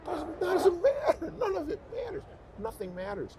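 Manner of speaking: high, slow, laughing-crying letting go; breaking into laughter as he tells himself nothing matters; laughing release. Retold or imitated. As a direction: laugh it off almost to tears. Telling himself to let go.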